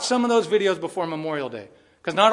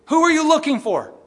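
loud, high, scolding question; harsh scowling take; stern Jesus; 10 semitones higher, 7 dB louder than usual. Shown as a demonstration. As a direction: bark it like a scowling master. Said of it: "I don't think he had a scowl".

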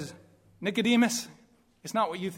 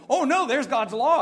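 high, loud "oh no"; hammed-up dismay at a sudden discovery; acted alarm; 6 semitones higher, 6 dB louder than usual. Preacher voicing a sinner who sees God's law, alarmed.

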